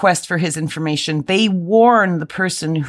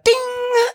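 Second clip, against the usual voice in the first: ding sound imitation.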